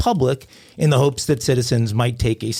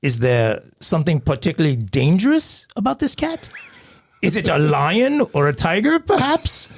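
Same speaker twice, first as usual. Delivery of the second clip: put-on British accent, sarcastic, high on perhaps; mock him in British accent; sarcastic British dispatcher; 4 semitones higher than usual. Dispatcher mocking caller about the cat, sarcastic British accent. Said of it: "The dispatcher".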